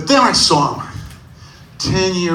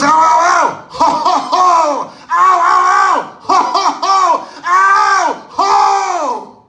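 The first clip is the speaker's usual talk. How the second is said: high, loud alternating ows and ho's; ow and ho clash; 12 semitones higher, 4 dB louder, slower than usual. Retold or imitated. Kid cries and Santa ho's battle, high and loud. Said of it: "He goes".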